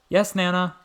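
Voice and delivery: high, loud answer across the yard; calling back. A narrator's reading. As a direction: call back to Nana, startled. Boy answers startled call.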